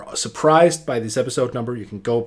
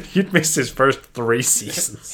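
talking through a laugh, slower; 9 semitones higher, slower than usual. Real, not acted. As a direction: chuckle the words out. Joke about Philly injuries.

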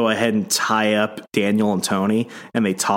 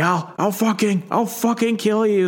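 much higher, furious threat; mock enraged scream; 11 semitones higher than usual. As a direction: spit the threat out in rage. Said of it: "Daniel's just screaming".

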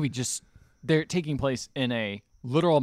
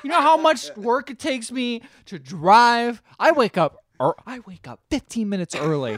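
mock boss; 8 semitones higher than usual. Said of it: "a boss coming in".

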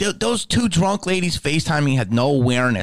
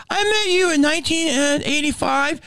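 imitating a clueless fan, much higher; dopey nostalgic fan, pitched up. Fan ignoring the smell, high dopey voice.

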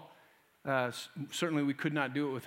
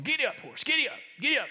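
urging the horse; 9 semitones higher, faster than usual. Retold or imitated.

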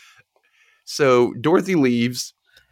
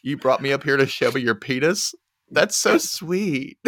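sarcastic; 2 semitones higher than usual. Retold or imitated. Woman answering his surprise reveal, sarcastic cooing. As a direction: gush it with fake sweetness, pitched up at the end. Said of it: "She says it sarcastically".

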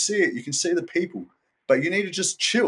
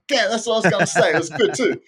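higher, louder mock voice of himself rewatching; host mimics himself rewatching the episode, higher and louder; future self watching back; 10 semitones higher, 6 dB louder than usual. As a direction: play yourself smugly agreeing later. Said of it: "I'm gonna watch this back".

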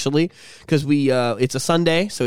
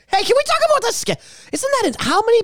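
excited. Real, not acted. Sudden excited topic change.

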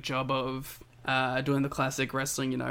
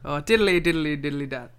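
put-on goofy Irish-accent riff, pitched up a touch; mock Irish accent; 4 dB louder than usual. Podcaster riffing on Kyle's impression of Finn for his co-host, mock Irish lilt. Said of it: "an Australian doing a Canadian doing an Irishman".

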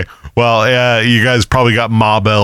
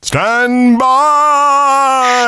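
announcer voice; 12 semitones higher than usual. Pretend. Shouted soundboard-style callout.

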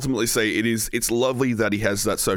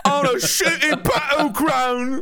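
mock voice; much slower than usual. Mock retort in put-on voice.

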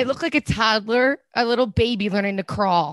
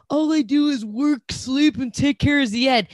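mock whiny complaint; 5 semitones higher than usual. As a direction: imitate her self-pitying whine. Mocking a cast member's complaint.

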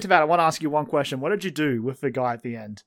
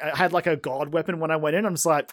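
normal talking voice

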